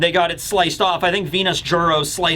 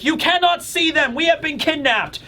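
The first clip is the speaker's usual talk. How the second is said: put-on higher-pitched boastful kid voice; mock confident kid; 8 semitones higher than usual. Colin lecturing the kidnapped children, mock heroic confidence. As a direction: play a boy grandly taking charge. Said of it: "at least Colin is being confident".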